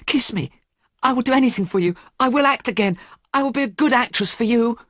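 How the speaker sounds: lightly voiced young woman, frantic; desperate young actress. A narrator's reading. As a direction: beg him not to leave. Sybil begs Dorian.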